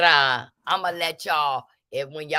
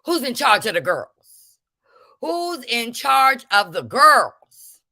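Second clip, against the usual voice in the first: loud, high demanding call; mock confrontation shout; 5 semitones higher, 6 dB louder than usual. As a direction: bark the question at her face. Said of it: "in her face and said".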